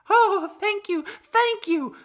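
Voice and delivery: very high, loud falsetto thanks; exclaiming grateful woman. A narrator's reading. Cured woman thanks the pig, high exclaiming relief. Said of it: "the woman exclaimed".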